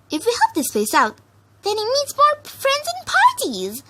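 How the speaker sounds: a giddy pony dreaming of parties; bubbly Pinkie voice. A narrator's reading.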